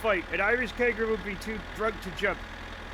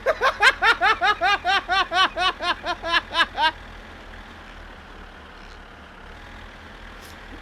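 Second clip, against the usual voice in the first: long laugh; 7 semitones higher than usual. Real, not acted. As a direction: burst out laughing at the story. Laughing at security story.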